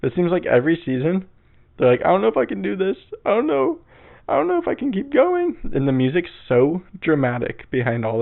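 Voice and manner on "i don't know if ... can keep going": high, whiny imitation contestant; mock distraught. Imitating contestants who want to give up, mock distraught. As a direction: climb into a hammed-up tearful squeak.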